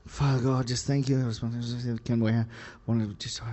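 soft, half-whispered mock prayer; mock hushed prayer. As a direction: trail off into a whisper like a distracted prayer. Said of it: "sometimes we pray".

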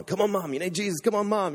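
high, insistent pestering; imitated nagging. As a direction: nag like an over-keen young convert. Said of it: "I just was on at her all the time".

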